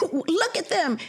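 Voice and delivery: high pointed look at them; indignant. Retold or imitated. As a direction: call it out, outraged, pitch up. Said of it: "And my son goes".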